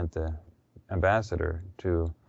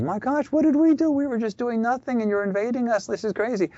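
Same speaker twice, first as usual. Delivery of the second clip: pitched way up in mock outrage; shocked outcry. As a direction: cry it out way up high in disbelief. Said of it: "were shocked".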